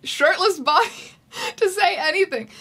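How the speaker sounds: high, loud, laughing through the words; cracking up while reading; breaking down in laughter. Real, not acted.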